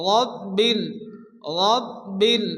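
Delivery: রব্বিল with the b pressed twice; doubled b. Shown as a demonstration. Teacher demonstrating the shadda in রব্বিল. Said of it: "অক্ষরটিকে দুবার পড়তে হয়".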